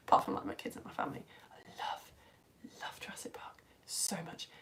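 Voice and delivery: very soft, near-whispered confession; hushed devotion. Real, not acted. Confessing love of Jurassic Park to viewers, hushed whisper. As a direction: drop almost to a whisper, overcome.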